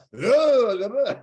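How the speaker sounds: loud, high mock-French exclamation; exaggerated French noise. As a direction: blurt a hammed-up Frenchy noise. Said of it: "be more Frenchy".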